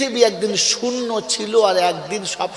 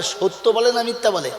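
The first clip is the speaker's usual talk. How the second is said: ordinary talk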